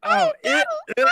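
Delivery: very high, loud squeals; hammed-up hentai squealing that breaks into laughter; hentai girl. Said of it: "do it as a hentai girl".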